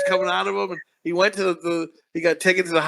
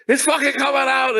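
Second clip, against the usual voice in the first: high, strained outburst; mock shout; 8 semitones higher than usual. Cop's inner Gator breaks out, mock shout. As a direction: erupt as if losing control. Acting the alter ego erupting.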